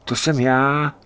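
high-pitched reply with a hint of French; French-speaking spook. A narrator's reading. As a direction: answer as a startled French-speaking stranger. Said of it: "odpovídá strašidlo francouzsky".

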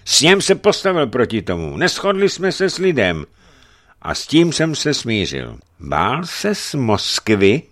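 high, loud accusing voice; indignant churchman's reproach; stern bishop. Acted. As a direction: rebuke the boyar forcefully.

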